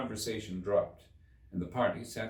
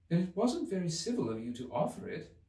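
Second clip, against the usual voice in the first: angry; 9 semitones higher than usual. A narrator's reading. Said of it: "said Alice angrily".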